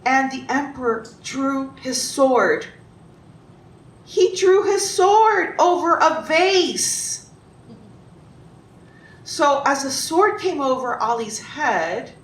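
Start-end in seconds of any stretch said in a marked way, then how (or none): 4.0-7.3 high, loud, drawn-out incredulity; dramatic disbelief. A narrator's reading. Storyteller to listeners about the emperor's sword, in loud disbelief. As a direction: stretch it out, appalled at such an overreaction.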